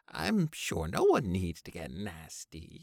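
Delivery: high, nervous placating; Babe voice. A narrator's reading. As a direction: soothe them nervously.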